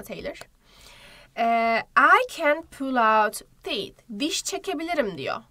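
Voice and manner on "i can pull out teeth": slow example. Shown as a demonstration. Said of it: "example sentence read slowly".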